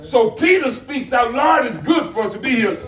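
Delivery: animated sermon storytelling; full-voiced preaching. Pastor retelling Peter's words to the church, preaching. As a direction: preach it out, punching key words.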